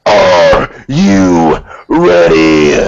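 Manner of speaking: very slow, low, loud drawn-out call; DX catchphrase. Retold or imitated. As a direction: stretch it like a ring entrance. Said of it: "let me say it like D X".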